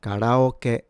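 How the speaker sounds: karaoke said the Japanese way, ka-ra-o-ke; Japanese pronunciation. Shown as a demonstration. Teacher modelling a famous Japanese word for learners, Japanese pronunciation. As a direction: say karaoke with tapped r and a final ke. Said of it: "This is pronounced".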